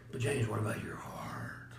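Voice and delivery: hushed imitating whisper. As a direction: whisper like a concerned guru. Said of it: "He goes".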